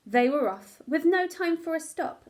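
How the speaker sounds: plain reading voice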